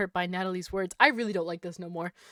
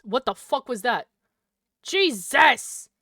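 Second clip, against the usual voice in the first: loud sudden yelp of fright; startled yelp; 3 semitones higher, 11 dB louder, slower than usual. Real, not acted. Gamer startled by a noise, loud yelp. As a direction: jump and yell. Another jump scare.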